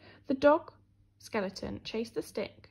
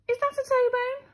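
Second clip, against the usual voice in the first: high, small puzzled voice; a tiny skeleton asking, higher up; little skeleton voice; 13 semitones higher, 6 dB louder than usual. Acted. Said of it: "the little skeleton said".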